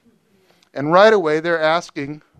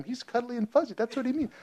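mock cutesy; 8 semitones higher, 11 dB quieter than usual. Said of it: "go, "Oh, lamb".

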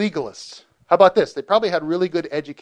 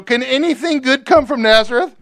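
higher, louder mocking question; scornful doubt; 9 semitones higher, 5 dB louder than usual. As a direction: sneer at the town. Nathanael scoffs.

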